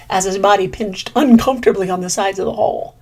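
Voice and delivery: usual speaking voice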